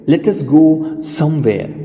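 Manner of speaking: very slow, word by word; teacher models it slowly for students; slow model sentence. Shown as a demonstration. Slow drilled sentence for learners. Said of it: "બોલો".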